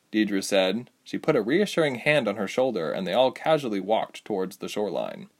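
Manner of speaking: ordinary delivery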